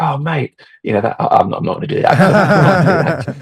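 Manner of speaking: a playful Aussie impression breaking into a laugh; Ange's Australian accent. Quoting Ange turning down the photo, mock Australian accent. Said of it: "I'm doing the accent again".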